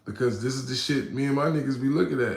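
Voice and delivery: ordinary delivery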